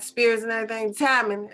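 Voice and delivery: normal talking voice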